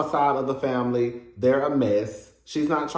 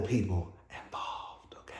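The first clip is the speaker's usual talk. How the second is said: hushed aside; 9 semitones lower, 14 dB quieter than usual. Real, not acted. Pointed aside about those involved.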